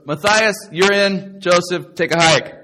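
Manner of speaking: loud, punchy captain's calls; mock team picking. God picking his kickball team of apostles, loud mock call-out. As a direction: call out the picks, brusquely dismissing the last.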